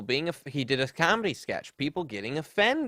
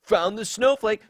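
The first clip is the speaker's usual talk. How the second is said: high mocking smug taunt; a high, sneering jeer; 8 semitones higher, 5 dB louder than usual. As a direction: sneer it back at him.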